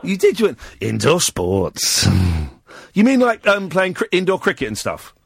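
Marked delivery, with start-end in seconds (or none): 0.8-2.1 very slow, low imitation of a seductive caller; mock sex voice. Host mimicking the caller's item, mock sex voice. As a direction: draw it out low and suggestive, hamming it up. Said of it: "you said that in a sex voice".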